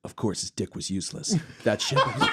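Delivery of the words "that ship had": line dissolving into a laugh; breaking into laughter. Real, not acted. Reader cracking up mid-sentence, laughing. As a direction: try to read on but crack up.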